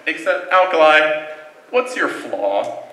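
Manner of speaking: usual speaking voice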